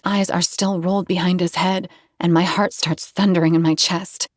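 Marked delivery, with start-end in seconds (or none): none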